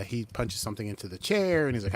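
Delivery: ordinary talk, nothing marked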